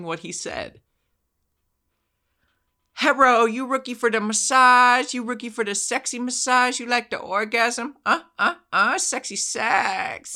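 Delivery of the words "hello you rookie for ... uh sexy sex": high, loud, badly faked woman's voice with an accent; a man faking a woman, pitched way up; terrible lady voice. The scammer pretending to be one of the girls, in a badly faked high lady voice. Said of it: "him doing a terrible lady accent".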